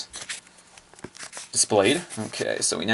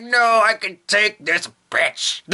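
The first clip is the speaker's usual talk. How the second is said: higher, slow defiant voice; Musashi's voice; 10 semitones higher, slower than usual. Acted. Musashi refusing repairs, higher and defiant. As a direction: shrug off the help boldly. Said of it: "And she's like".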